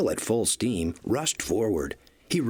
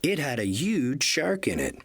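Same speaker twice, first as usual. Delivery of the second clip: ordinary delivery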